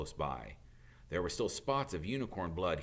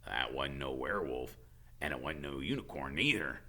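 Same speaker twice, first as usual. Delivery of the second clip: grim; 8 semitones lower, 5 dB quieter than usual. Acted. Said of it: "said Hagrid grimly".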